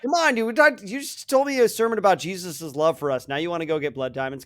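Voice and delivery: high, exasperated plea; pleading churchgoer. Retold or imitated. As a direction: protest in disbelief.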